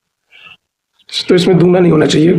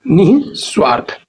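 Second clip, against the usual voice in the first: निस्वार्थ slowly as nih, pause, swaarth; slow syllable demo; slower than usual. Shown as a demonstration. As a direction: break निस्वार्थ after the first syllable. (निस्वार्थ धीरे, 'निः' के बाद रुककर)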